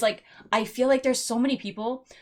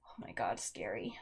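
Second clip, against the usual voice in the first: hushed; 14 dB quieter than usual. Real, not acted. Hushed reaction to a scary scene.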